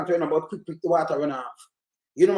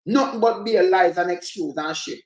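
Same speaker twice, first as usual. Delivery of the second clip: ordinary talk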